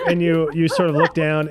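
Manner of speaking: ordinary delivery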